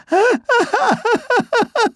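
very high, rapid pitiful gasps; squeaky breathless panting; gasping whimper. Acted. Chipmunk squashed under Bear's paw, gasping.

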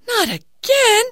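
high, loud exclamation; exasperated young girl; dismayed daughter. Acted. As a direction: groan in frustration.

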